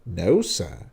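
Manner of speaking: exaggerated courtesy with a big pitch swing; mock politeness. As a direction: feign polite surprise, then drop the pitch to deny it. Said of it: "this kind of mock politeness again".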